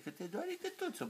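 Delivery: high, soft tender coo. Real, not acted. Father soothing baby.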